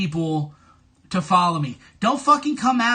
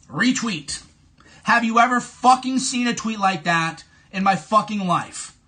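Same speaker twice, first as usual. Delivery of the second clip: normal talking voice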